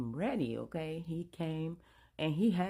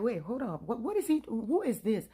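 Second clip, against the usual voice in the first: louder and higher, stunned husband; shocked outrage; 5 semitones higher, 6 dB louder than usual. Retold or imitated. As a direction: demand answers, shaken.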